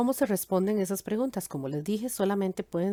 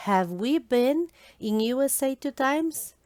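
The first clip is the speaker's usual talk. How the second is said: slow example question; 5 semitones higher, slower than usual. Shown as a demonstration. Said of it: "por ejemplo".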